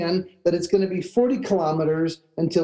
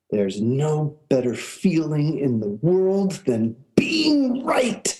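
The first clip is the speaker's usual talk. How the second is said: gloating; 2 semitones lower, slower than usual. Retold or imitated. Traveler to friend after winning the argument, gloating.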